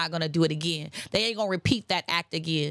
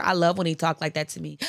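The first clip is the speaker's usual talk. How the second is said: normal talking voice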